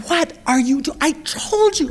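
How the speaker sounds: high pitched retold yell; mock scream at child. Mother recalling yelling at her hurt son, mock yell. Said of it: "I just screamed at him".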